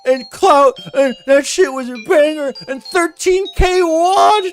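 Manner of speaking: very high, wailing mock sob through the quotes; mock crying voice. Streamer mocking a rival's sad Discord post, wailing high. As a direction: ham up a crybaby reading his own sad posts.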